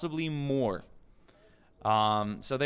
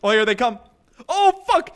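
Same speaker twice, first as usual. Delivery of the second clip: loud, much higher yell; excited shout; 11 semitones higher, 13 dB louder than usual. Real, not acted. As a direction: shout in alarm. Enemies storm in.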